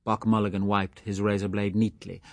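plain reading voice

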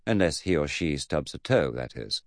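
usual speaking voice

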